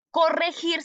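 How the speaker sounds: "corregir" with throaty j sound in "gir"; correct pronunciation. Shown as a demonstration. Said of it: "se escribe con G".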